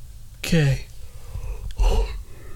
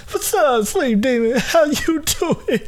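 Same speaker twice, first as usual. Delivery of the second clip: higher-pitched, laughing hello; playful greeting; 6 semitones higher than usual. Acted. Someone greeting Sleep Demon like an acquaintance, playful high voice.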